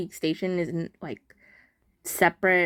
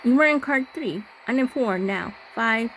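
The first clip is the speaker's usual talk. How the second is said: lightly voiced, higher, eerie stalker; creepy stalker voice; 4 semitones higher than usual. Player voicing the stalker closing in, slightly raised voice.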